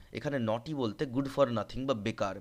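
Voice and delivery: ordinary talk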